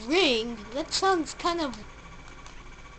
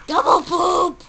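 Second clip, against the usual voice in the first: loud, playful yell; silly shout; 5 semitones higher, 10 dB louder, slower than usual. Real, not acted. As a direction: shout it out as a joke.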